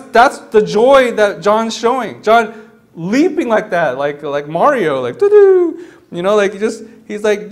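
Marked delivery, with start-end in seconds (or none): 4.0-5.9 imitated game jump, pitched way up; Mario jump sound. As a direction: sing out a loud video-game jump noise.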